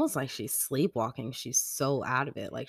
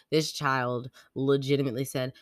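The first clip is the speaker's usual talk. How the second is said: ordinary delivery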